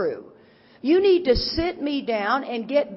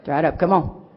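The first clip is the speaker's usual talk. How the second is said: firm telling-off; 6 semitones lower, 4 dB louder than usual. Retold or imitated. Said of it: "I'm like going".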